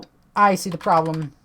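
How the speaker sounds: ordinary talk, nothing marked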